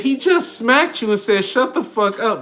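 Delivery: high and loud, slow, disbelieving; incredulous retelling. Retold or imitated. Retelling to Rissa what he told a woman, high and loud in disbelief. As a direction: spell out the slap and the weak answer. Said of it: "I said".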